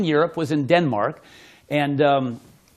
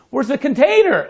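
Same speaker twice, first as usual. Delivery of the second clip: mock puzzled; 8 semitones higher, 4 dB louder than usual. Retold mock confusion at trick.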